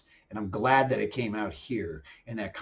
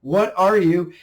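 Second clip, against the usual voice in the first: mock terror; 5 semitones higher, 6 dB louder than usual.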